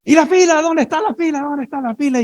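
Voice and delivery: high, indignant mock yell; retold shout. Man in the elevator crowd shouting about the queue, high mock yell. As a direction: ape the angry man's high shout.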